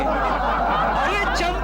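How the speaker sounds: long, drawn-out high greeting; excited greeting. Acted. Friend hails Champu with good news, excited drawn-out greeting. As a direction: call out the name slowly, pitched well up.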